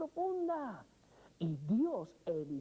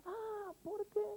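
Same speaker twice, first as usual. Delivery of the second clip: exasperated cry; 12 semitones higher, 3 dB quieter, slower than usual. Retold or imitated. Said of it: "hacer saltar en mí esos enojos".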